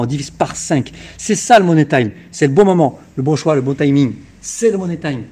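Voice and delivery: loud, punchy sales pitch; infomercial. Acted. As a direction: hype it up like a TV car dealer. Car dealer hard-sell pitch.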